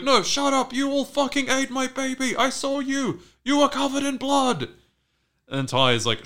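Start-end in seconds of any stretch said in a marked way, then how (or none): 0.0-4.7 high, loud hysterical imitation; acted frantic fury; screaming accusation. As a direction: shriek the accusations at the whole group. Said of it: "Shauna starts screaming at Misty".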